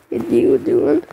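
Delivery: ordinary talk, nothing marked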